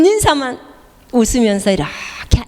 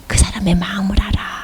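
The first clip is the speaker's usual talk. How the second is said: whisper; 3 dB quieter than usual. Acted. Whispered intimate line.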